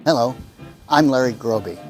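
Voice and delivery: ordinary talk, nothing marked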